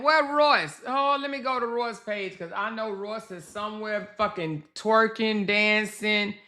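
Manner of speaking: usual speaking voice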